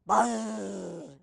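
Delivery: a stomping fly's loud buzz. Acted. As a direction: buzz it out like an angry fly. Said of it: "He stomped his foot and said".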